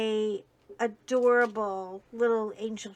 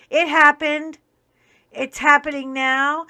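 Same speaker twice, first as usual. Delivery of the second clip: real indignation; 3 semitones higher, 10 dB louder than usual. Real, not acted. Angry rant about missing child.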